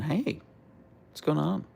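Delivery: bright high greeting; high-pitched greeting. Real, not acted.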